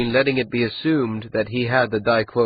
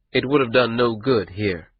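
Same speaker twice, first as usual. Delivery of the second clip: plain reading voice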